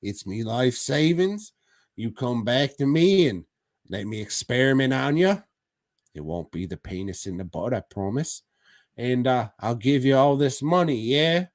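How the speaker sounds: hammed-up "oi mate" accent with rising tags; mock German guy, Cockney-style accent. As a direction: pitch the deal in a broad comic Cockney-style accent. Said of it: "the German guy was like".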